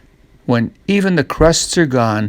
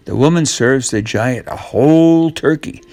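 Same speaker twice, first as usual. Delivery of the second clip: plain reading voice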